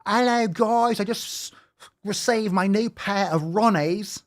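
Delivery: high-pitched mock Aussie influencer; put-on Aussie twang, poking fun; mocking Australian accent. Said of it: "have to talk".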